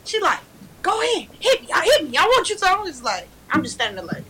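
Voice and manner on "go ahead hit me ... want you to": high-pitched mock dare; imitated yelling mom. Mom daring child to hit back, imitated yelling. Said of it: "really yelling".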